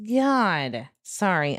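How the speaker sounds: ordinary delivery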